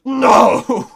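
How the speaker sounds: loud, high imitated cry of no; mock shout. Retelling Victor's big line to viewers, shouted. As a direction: belt out a cartoonish no. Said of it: "Victor just comes out with".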